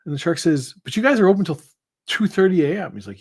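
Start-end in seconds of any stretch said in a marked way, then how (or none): 0.8-2.9 higher, louder incredulous complaint; indignant protest. Acted. Shark protesting to bartender that the bar stays open, indignant. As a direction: argue it in disbelief. Shark outraged kitchen closed.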